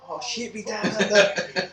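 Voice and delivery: put-on Australian accent. Mock Aussie banter, laughing.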